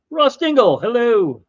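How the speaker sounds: loud, high cheerful hello; excited greeting. Real, not acted. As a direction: call out the name happily. Greeting a chatter on stream.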